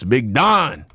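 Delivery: normal talking voice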